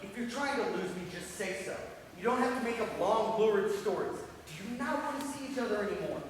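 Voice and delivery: loud, escalating confrontation; angry challenge. Acted. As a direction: demand an answer from your partner.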